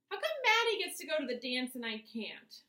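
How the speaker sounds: put-on high, loud whining little boy; loud whine. A narrator's reading. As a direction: whine so mom hears in the kitchen. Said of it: "so he whines extra loud".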